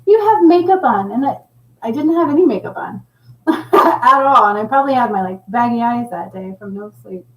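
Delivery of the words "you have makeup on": put-on high, blunt kid voice; lightly voiced little boy accusing. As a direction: accuse like a cheeky five-year-old. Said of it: "He's like".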